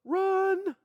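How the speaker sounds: high-pitched, drawn-out cry to flee; mock alarm. Acting out fleeing the smoking temple.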